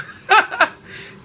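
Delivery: sudden bright laugh that falls away; short laugh. Real, not acted. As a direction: burst into a quick laugh. Laughs at own party story.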